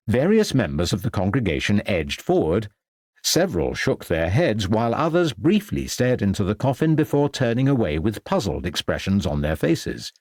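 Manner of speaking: usual speaking voice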